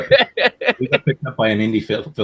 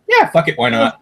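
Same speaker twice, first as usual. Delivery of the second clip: carefree yes; 13 semitones higher, 4 dB louder than usual. Retold or imitated. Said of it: "I was like".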